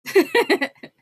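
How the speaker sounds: loud, high laugh; high burst of real laughter. Real, not acted. Laughing at the beans rant.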